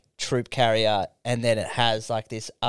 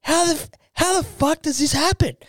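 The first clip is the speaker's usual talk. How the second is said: much higher, disbelieving mock outburst; exasperated boyfriend. Boyfriend staring at the sand-filled tray, in high exasperation. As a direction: throw up your hands in exaggerated disbelief. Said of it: "He's like".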